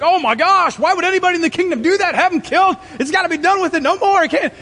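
hammed-up outrage at an imagined villain; outraged king. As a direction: play an outraged king demanding punishment. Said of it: "David's like".

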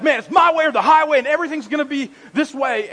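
loud, high-pitched bulldozing shout; intense pastor. Retold or imitated. Fiery pastor laying down the law to congregation, intense shout. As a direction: imitate a pastor who overrides everyone. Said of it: "just intense, and, and they're like".

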